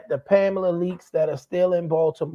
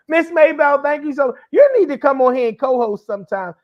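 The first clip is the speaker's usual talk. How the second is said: higher, louder laughing thank-you; 8 semitones higher, 6 dB louder than usual. Real, not acted. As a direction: gush through laughter.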